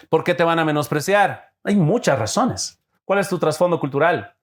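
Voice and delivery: ordinary talk, nothing marked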